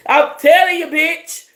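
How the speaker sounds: high and loud, hyped; excited. Real, not acted. Reader hyping viewers, high and loud. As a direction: shout it at viewers, thrilled.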